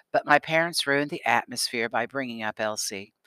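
plain reading voice

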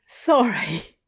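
a touch of a choked voice; choked, hoarse. Acted. Adam apologising to Crowley, choked. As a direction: get it out through a tight throat. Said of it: "Adam choked hoarsely".

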